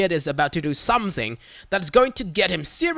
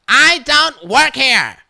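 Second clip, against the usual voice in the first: curt, louder and higher, slow dismissive retort; snippy Karen; 7 semitones higher, 8 dB louder, slower than usual. Retold or imitated. Karen brushing off the shopper, slow irritated snap. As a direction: snap it without looking up.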